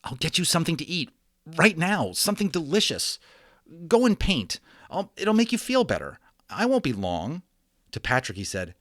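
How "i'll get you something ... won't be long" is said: put-on high, soothing young boy; coaxing boy voice. A narrator's reading. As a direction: coax him gently like a kid.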